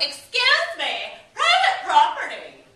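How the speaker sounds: high, loud, drawn-out scolding yell; slow, high mock outrage; indignant yell. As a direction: imitate a young lady yelling at a trespasser. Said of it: "yells out".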